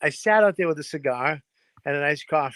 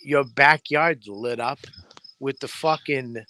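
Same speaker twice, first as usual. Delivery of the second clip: ordinary talk, nothing marked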